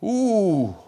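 high, loud admiring ooh; a sudden admiring coo. Real, not acted. Preacher reacting to the vow with a high loud ooh.

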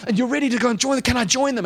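high, climbing pitch with mounting excitement; dramatic preaching. Acted. Preacher longing to join those on the mountain, excited and urgent. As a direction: build it like the swelling trumpet. Preacher builds to trumpet climax.

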